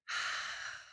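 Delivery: breathy demo exhale; whisper scream. Shown as a demonstration. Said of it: "make this kind of sound".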